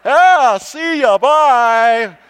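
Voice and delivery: high, loud taunting farewell; hammed-up passerby brushing him off; mocking passing drivers. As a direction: wave them off in a sing-song mock.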